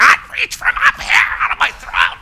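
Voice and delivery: thin, high preaching from the throat. Shown as a demonstration. Young preacher straining his throat, high and thin. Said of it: "to talk from our diaphragm".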